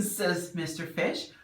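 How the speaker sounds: plain reading voice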